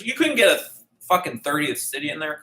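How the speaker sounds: high, loud burst of indignation; exasperated outburst. Real, not acted. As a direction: protest in mock outrage, pitch shooting up. Mock outrage at the Bible.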